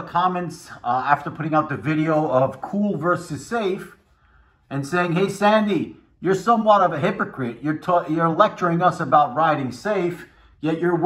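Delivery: speaking behind face guard; talking through helmet muzzle. Real, not acted. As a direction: mouth covered by muzzle. Seen 3: Helmet's hard muzzle covers his mouth in every frame throughout.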